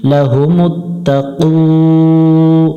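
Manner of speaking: اتقوا with the final vowel drawn out; one-alif madd. Shown as a demonstration. Teacher models the madd on اتقوا for students, slowly. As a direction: lengthen the end of اتقوا one count. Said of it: "এক আলিফ টান দেব".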